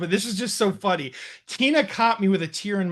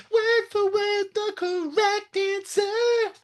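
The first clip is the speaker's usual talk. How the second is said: hyped-up high voice; 13 semitones higher, much slower than usual. Acted. Hyped podcast intro bit.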